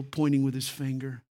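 ordinary talk

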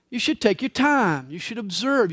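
normal talking voice

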